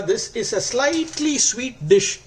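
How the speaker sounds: "slightly sweet" lifted high and drawn out slowly; stress on slightly sweet. Shown as a demonstration. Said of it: "I want to stress on the word slightly sweet".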